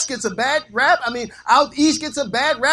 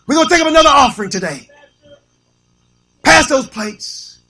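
loud, hammed-up offering call; money-raising preacher parody; 4 semitones higher, 7 dB louder than usual. Preacher calling for another offering, loud mock bellow. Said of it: "He gonna get there and start going".

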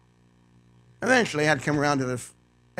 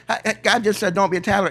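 mock objection; 6 semitones higher, 6 dB louder, faster than usual. Voices an objector misquoting God.

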